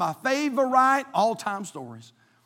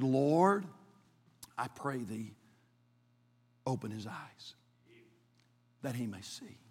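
quiet prayer; 7 semitones lower, 11 dB quieter than usual. Acted. Elisha praying.

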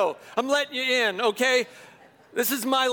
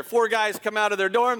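usual speaking voice

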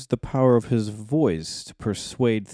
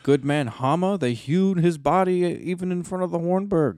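hammy stage actor; 8 semitones higher than usual. Retold or imitated. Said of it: "He's like".

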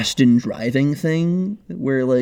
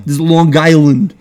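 loud, badly done Long Island accent; Christopher Walken; 3 semitones higher, 9 dB louder than usual. Shown as a demonstration. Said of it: "That's just a bad Christopher Walken".

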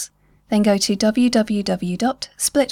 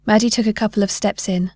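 ordinary delivery, nothing marked

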